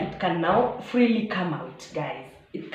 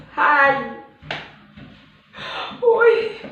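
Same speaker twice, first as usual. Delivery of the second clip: loud, high alarmed yelps; shocked shouts of alarm; panicked cry; 7 semitones higher, 6 dB louder than usual. Retold or imitated. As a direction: cry out in sudden horror. Finger fell off, panic.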